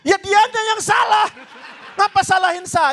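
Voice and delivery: high, shouted defensive protest; mock outrage. Host blames the guest for saying amen, mock outrage. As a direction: argue back indignantly, voice jumping up. Mock quarrel over who is at fault.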